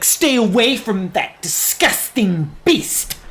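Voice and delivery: slow, haughty disdain, lightly voiced; snooty Shadowheart. Shadowheart warning the player off the owlbear cub, slow and scornful. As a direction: sneer it down at the beast. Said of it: "Shadowheart's like".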